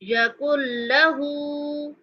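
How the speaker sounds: यकुल लहू with the final हू held two counts; two-count madd. Shown as a demonstration. Teacher showing the long vowel in लहू. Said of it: "टू counts लंबा करके पढ़ेंगे".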